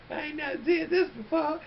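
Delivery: high laughing exclaim; giddy laugh-talk. Retold or imitated. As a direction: giggle through it, high. Giddy retold thought.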